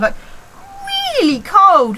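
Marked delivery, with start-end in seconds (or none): 1.2-2.0 "really" drawn out high and louder; emphatic stretch. Real, not acted. Explaining to viewers why coat was on, "really" stretched high for emphasis. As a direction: lean long and hard on "really". Exaggerating how cold it was.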